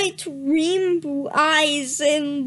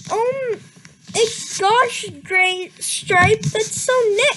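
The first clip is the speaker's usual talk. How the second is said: mock scream; 6 semitones higher than usual. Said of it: "Starkit screamed".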